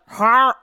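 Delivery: short throaty attempt at a dog growl; imitated dog growl. Imitating Lola attacking Benny, dog growl. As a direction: mimic a dog going at another. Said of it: "I can't even make the noise".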